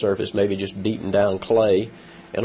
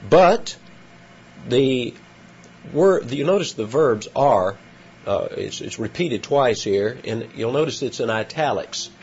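ordinary talk, nothing marked

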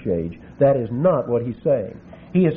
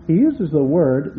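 usual speaking voice

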